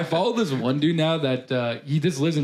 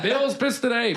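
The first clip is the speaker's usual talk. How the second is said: put-on Canadian accent; 7 semitones higher, slower than usual. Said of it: "a very strong Canadian accent".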